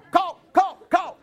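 sudden high, loud shout of the word; mocking yell from the bushes. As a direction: bark it like a heckler hidden in the trees. Neighbors yelling from woods.

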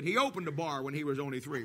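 quiet deadpan punchline; deadpan joke version. Retold or imitated. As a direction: mutter the gag lyric dryly. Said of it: "Now they changed it".

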